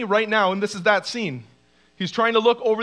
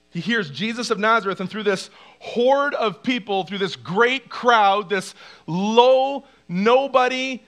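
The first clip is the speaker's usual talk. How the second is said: normal talking voice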